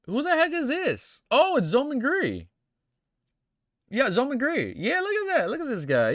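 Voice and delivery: high, loud thrilled exclamations; excited. Real, not acted. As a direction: gush over the drawing, voice jumping up. Excited reaction to fan art.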